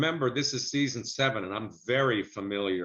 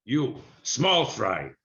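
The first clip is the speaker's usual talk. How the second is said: put-on slow, stern Middle Eastern accent, a bit louder; Soup Nazi voice; 4 dB louder, slower than usual. Improvising the Soup Nazi for a comedian friend, in a slow stern Middle Eastern accent. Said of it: "He'd probably say something like".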